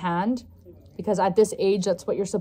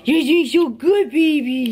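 squeaky praising a dog; 5 semitones higher, 4 dB louder, slower than usual. Real, not acted.

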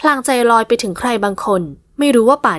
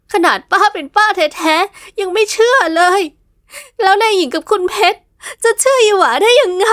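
sobbing; 9 semitones higher than usual. Acted. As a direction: sob out the words in despair. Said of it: "หญิงสาวสะอื้นจนตัวโยน".

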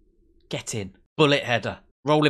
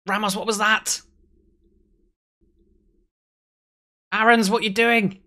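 high, raised cry of disbelief; exasperated yell; 10 semitones higher, 6 dB louder than usual. Real, not acted. Gamer to defender Aarons on a blunder, exasperated. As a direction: tell off a player who just blundered. Calling out own players' mistakes.

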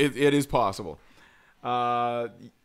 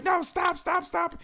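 much higher mock cry of stop; lady yelling stop; 14 semitones higher, 3 dB quieter, slower than usual. Dog owner shouting stop at her biting dog, high mock panic. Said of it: "the lady's like".

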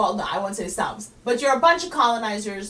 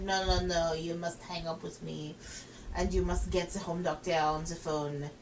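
put-on low, soft French accent; dismissive French-accented refusal, kept low; French operator; 6 semitones lower, 7 dB quieter than usual. French operator tells the caller to hang up, dismissive French. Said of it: "in the most French way".

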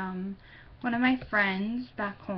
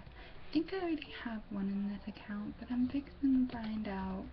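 muttered aside, unvoiced; hushed aside to herself; whisper; 6 dB quieter than usual. Real, not acted. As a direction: whisper to herself while busy. Aside while busy, whispered.

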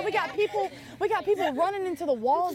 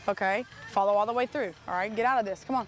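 ordinary talk

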